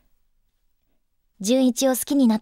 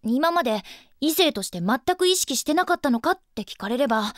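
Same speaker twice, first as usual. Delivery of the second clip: ordinary talk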